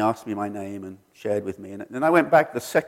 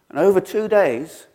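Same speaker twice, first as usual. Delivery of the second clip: usual speaking voice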